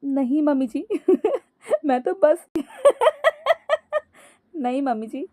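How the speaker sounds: denial breaking into high cackles; laughing. A narrator's reading. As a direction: try to answer, collapse into laughter. Daughter-in-law cannot stop laughing. (हंसी से बेकाबू)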